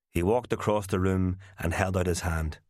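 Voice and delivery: ordinary delivery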